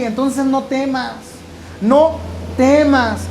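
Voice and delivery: high, very slow no temas; slow reassurance. Acted. The Lord calms a frightened disciple, slow and soothing. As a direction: draw out each word like a calming hand.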